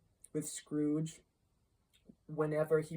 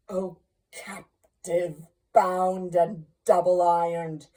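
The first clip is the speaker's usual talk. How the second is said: loud, drawn-out ghostly cry; phantom's cry; 10 dB louder, slower than usual. A narrator's reading. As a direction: swell to a big lamenting call. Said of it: "cried the phantom".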